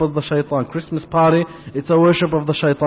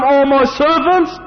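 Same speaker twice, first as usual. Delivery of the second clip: high, slow proclamation; exalted divine address; God addressing His servants; 10 semitones higher, 4 dB louder, slower than usual. Acted. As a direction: call out grandly, drawing the words out. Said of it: "telling them".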